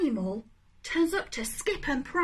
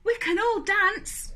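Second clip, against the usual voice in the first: higher, brighter proud line; joyful declaration; 8 semitones higher, 5 dB louder than usual. Acted. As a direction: announce it gladly. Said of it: "he said".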